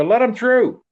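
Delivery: high, loud call over a crowd; high, loud retold call. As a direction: shout it up high to make them open the way. Calling out to the crowd.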